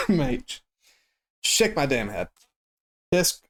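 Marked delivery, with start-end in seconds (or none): none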